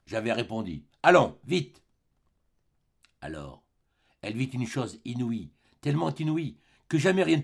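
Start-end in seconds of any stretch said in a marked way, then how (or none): 1.0-1.8 loud, brusque command to hurry; curt order. Acted. Javert orders the mayor to come, loud and brusque. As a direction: bark it out like a policeman making an arrest.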